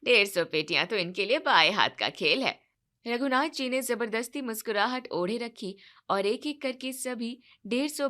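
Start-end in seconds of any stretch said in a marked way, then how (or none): 0.0-2.6 low, loud wedding guest talking up the groom's side; a relative bragging about the bride's father; boastful relative. Acted. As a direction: lower voice, pushy and proud.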